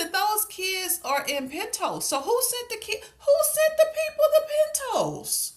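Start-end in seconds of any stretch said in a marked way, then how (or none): 2.0-5.5 squeaky, much higher disbelieving cry; incredulous squeal. Real, not acted. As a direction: shriek the question in disbelief. Exasperated at plot confusion.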